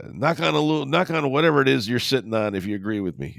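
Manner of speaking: a hint of the coach firing up the room; imitating Gruden. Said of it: "He's like".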